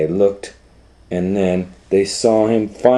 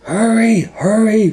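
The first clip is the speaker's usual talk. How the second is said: urgent hurry; 14 semitones higher, 3 dB louder than usual. Acted. Firemen urging.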